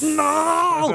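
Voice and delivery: high panicked cry of no. Retold or imitated. Tripping on the hotel floor, crying out no in mock panic. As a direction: cry out in mock horror at the dripping walls. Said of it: "And I'm like".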